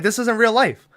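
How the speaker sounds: high, breezy denial; dismissive cop. Retold or imitated. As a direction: brush it all off lightly, as the cop. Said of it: "he's like".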